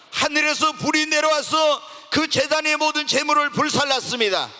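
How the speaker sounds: loud, high, fervent sermon delivery; preaching at full force. Acted. Preacher urging his congregation to pray, preaching at full force. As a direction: drive it home to the whole congregation. Preacher retells Elijah's prayer.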